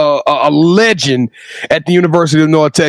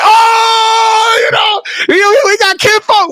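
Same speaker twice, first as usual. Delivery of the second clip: overjoyed shout; slower than usual. Retold or imitated. Fan hearing his old coach is back, overjoyed shout. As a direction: shout the news like you can't believe it. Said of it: "so we like".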